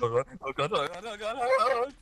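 oh god repeated in rising panic; panicked. Real, not acted. Panic in fight.

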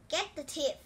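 urgent keeper's shout. A narrator's reading. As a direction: yell it across the chase. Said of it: "shouted the keeper".

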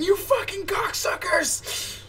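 high, drawn-out strangled mock scream. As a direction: imitate an outraged viewer screaming at the screen. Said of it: "screaming at their monitors".

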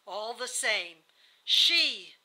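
loud, deliberate refusal; bitter refusal. A narrator's reading. Dying wife refusing her husband the children, bitter. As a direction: lean hard and loud on the key words.

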